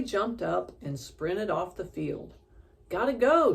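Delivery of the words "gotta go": high, loud hurried yell; girl yelling goodbye as she sprints off. A narrator's reading. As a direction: shout it over your shoulder while running. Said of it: "she yelled".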